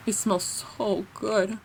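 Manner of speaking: "It smells so good" slow and soft, relishing it; savoring. Real, not acted. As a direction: linger dreamily on every word.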